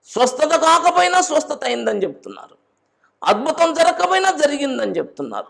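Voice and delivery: high, loud pulpit proclamation; full-force preaching. Acted. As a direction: preach it out to the congregation.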